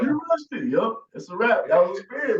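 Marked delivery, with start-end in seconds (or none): 0.0-0.7 high-pitched mocking rebuke; mock scolding. Acting out a rebuke to Rockefeller, high mock scolding. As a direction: scold him in a much higher voice.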